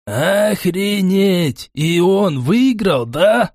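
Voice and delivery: high, stretched-out amazement; stunned excitement. A narrator's reading. Rushes up to Глайдер about the win, high and amazed. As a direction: gape aloud, dragging the words high. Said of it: "подскочил он к Глайдеру".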